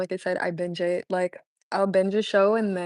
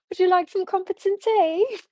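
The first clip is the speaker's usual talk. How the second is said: hammed-up British accent, pitched higher; 11 semitones higher, 4 dB louder than usual. Shown as a demonstration. Repeating her British line for chat, higher hammed-up accent. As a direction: sing out the posh British line, higher and brighter. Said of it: "I'll do a British accent".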